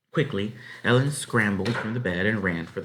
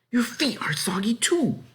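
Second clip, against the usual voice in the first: much higher, fussing motherly voice; concerned farm wife. A narrator's reading. Farm wife fussing over Ellen's wet shoes, high and motherly. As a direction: fuss over the girl's wet feet. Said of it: "she said".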